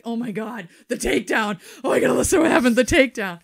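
loud and high, thrilled; excited. Retold or imitated. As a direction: burst out with excitement. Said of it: "and I'm like".

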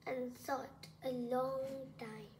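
ordinary delivery, nothing marked

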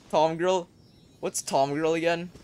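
ordinary talk

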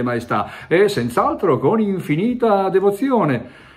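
bow and scrape with every word; answering His Majesty on the phone, high and servile; obsequious. Acted. Fawning replies to great dignitaries.

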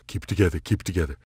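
steady yourself under your breath; muttered self-calming. Acted. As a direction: hero muttering to stay calm. Muttering to calm himself.

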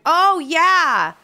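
loud, high oh yeah; excited remembering; sudden recall. Real, not acted. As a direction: burst out as it comes to mind. Suddenly remembering the freeze frame.

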